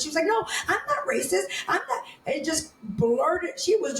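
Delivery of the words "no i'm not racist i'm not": high, hurried mock protest; defensive denial. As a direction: imitate her rushing to deny it. Acting out her indignant denial.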